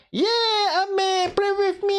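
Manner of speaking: high-pitched eager friend; excited high voice. Retold or imitated. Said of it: "Ellie will be like".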